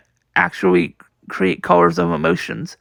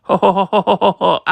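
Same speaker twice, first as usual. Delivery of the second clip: long staccato run, ha-ha into ho-ho; acted laughing fit; faster than usual. Luffy refusing to stop laughing, acted laughing fit. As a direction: keep a put-on laugh rolling and changing.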